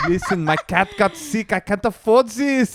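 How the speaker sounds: a hammed-up French accent, begging. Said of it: "I did the French accent".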